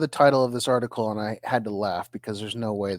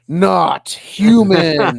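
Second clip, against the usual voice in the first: slowed right down and punched much louder; dramatic slow reveal; 5 semitones higher, 10 dB louder, much slower than usual. Acted. Reading the headline to a friend, slow and loud for drama. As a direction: drag it out like a big reveal.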